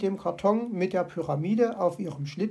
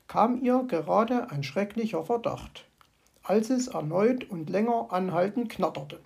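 ordinary delivery, nothing marked

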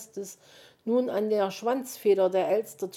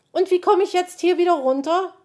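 high, loud, alarmed question; touch of panic; 12 semitones higher, 11 dB louder, faster than usual. A narrator's reading. Magpie stuck up high, a touch of panic. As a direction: ask it with rising alarm. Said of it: "leichten Anflug von Panik".

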